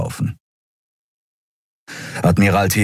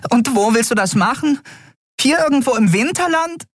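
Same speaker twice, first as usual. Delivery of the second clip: high, worried fairy question; a tiny girl's voice, anxious; small fairy voice. A narrator's reading. Nelen asks Keru about the unconscious captain, high and worried.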